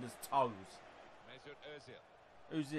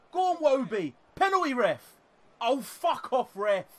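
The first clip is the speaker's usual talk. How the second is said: much higher and louder, yelling at the ref; furious shouting; 9 semitones higher, 7 dB louder than usual. Real, not acted. Gamer yelling at the ref over a missed penalty, furious shouting. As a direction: lose it completely at the referee. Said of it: "So much for being calm".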